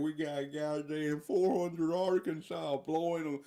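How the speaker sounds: high, slow hammed-up Arkansas drawl; mocking put-on Arkansas voice; Tom Cotton. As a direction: stretch it out like an outraged Southern politician. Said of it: "you got Tom Cotton out there in Arkansas saying".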